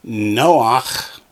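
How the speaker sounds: "Noach" as NO-ach, ending on a throaty ch; ah before the guttural in "Noach"; model for echoing. Shown as a demonstration. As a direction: model Noach clearly for repetition. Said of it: "Echo me, please".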